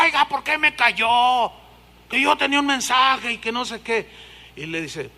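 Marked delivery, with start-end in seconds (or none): 0.0-4.1 a lightly voiced offended woman. Woman asking the pastor why he silenced her, offended. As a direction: complain like a slighted churchgoer. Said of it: "llega la señora".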